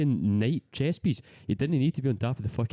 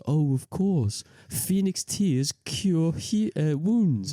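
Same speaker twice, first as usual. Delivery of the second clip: higher, drawn-out mock film line; mock film character; 6 semitones higher, much slower than usual. Mocking a film scene to co-hosts, hammed-up quote. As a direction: put on a knowing, theatrical delivery, lightly hammed up. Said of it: "and he goes".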